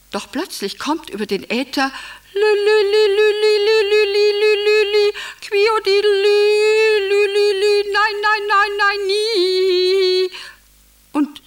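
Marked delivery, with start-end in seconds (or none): 2.3-10.3 high, loud monotone birdsong chant; nightingale code call. Acted. Husband's secret code coming over the ether, loud birdsong chant. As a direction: trill it like a frantic nightingale. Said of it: "der Schlag der Nachtigall erklingt".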